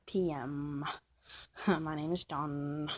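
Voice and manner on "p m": odd low P M. Real, not acted. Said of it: "I don't know why I said it like that".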